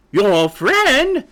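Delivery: loud, high, incredulous bark. A narrator's reading. Mother hen echoes her chick, barking in outrage. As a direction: throw it back at him, scandalized and loud. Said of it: "barked Sapphira".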